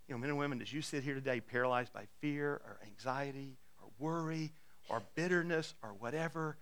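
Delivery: usual speaking voice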